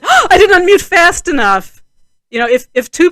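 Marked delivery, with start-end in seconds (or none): 0.0-1.8 panicked. Pretend. Acting a late unmute panic.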